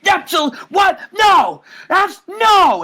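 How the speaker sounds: a champion losing it in disbelief; freaking out. Retold or imitated. Champion yelling as the challenger calls him out, freaking out. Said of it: "he's, he's freaking out".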